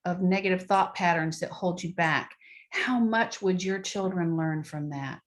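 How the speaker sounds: ordinary talk, nothing marked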